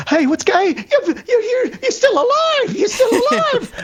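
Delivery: a dog thrilled you're back alive; overjoyed dog voice. Retold or imitated. Comparing pets, voicing an overjoyed dog greeting its owner, very high and laughing. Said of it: "a dog will run up and go".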